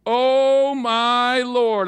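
loud, pleading cry of Oh, my Lord; a loud, wailing Moses crying out to God; anguished Moses. Acted. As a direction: wail it like a despairing man. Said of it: "I like to think that that's how he said it".

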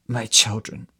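hoarse, strained voice after pain. Acted. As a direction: rasp the words out hoarsely. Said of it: "she said in a hoarse voice".

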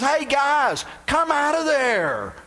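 loud, high, drawn-out call; hammed-up royal call. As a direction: call out loud and slow into the furnace. King calling into the furnace.

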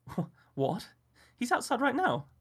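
high, stammering disbelief; a woman stunned by accusation; shocked woman. A narrator's reading. Felicity reacts in shock, high disbelief.

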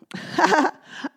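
high, loud cookie laugh; gleeful laugh. Acted. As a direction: laugh with glee. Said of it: "the gingerbread man just laughed".

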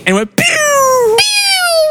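very high squeaky pew; firework pew. Retold or imitated. Telling how the firecracker shot past Noah, high pew.

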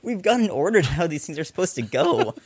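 high-pitched mock outrage; indignant henchman. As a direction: play an outraged henchman complaining about broken protocol. Voicing outraged henchman watching Bond.

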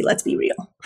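hushed aside; whisper. Real, not acted. Trainer whispering a knowing aside to viewers. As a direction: breathe it out knowingly.

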